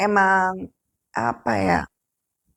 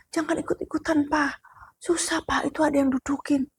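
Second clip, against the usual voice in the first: high, softer anxious plea; worried warning; 6 semitones higher, 4 dB quieter than usual. Retold or imitated. Child warning father not to help, anxious hush. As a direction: warn him gently but fearfully.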